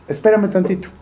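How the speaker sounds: Abraham's plea. Acted. Abraham asks God to wait a moment, lightly acted plea. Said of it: "Lo que le está diciendo a Dios es".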